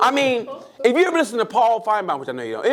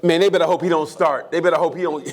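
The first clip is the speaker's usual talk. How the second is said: normal talking voice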